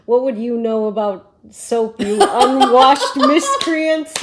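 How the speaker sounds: loud, slow, laughing haughty scold; mock haughty rabbi. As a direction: ham up a lofty rabbi's rebuke. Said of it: "he goes".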